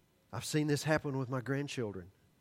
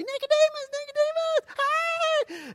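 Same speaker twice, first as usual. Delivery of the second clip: shrill-high excited call across the room, much louder; lady yelling a greeting; 6 dB louder, slower than usual. Retold or imitated. Lady at the book table yells to the preacher, much higher and louder. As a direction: belt out the name like an eager fan spotting him. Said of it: "this lady begins to yell at me".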